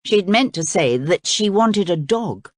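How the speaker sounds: plain reading voice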